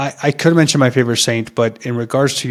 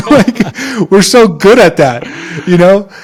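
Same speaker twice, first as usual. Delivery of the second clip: higher and louder with a laugh; laughing excitedly; 7 semitones higher, 7 dB louder, slower than usual. Real, not acted. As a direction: laugh as you gush. Laughing, excited about Mexican yelling.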